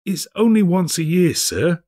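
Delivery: high, begging Bob; pleading clerk. A narrator's reading. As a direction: plead with the boss. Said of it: "pleaded Bob".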